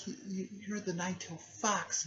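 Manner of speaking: high-pitched, stammered astonishment; imitated startled girl. Girl startled at seeing the fox.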